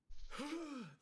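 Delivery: high, loud retold gasp; imitated gasp on waking; sharp gasp. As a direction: suck in air as if coming back to life. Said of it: "this, like, profound exhale".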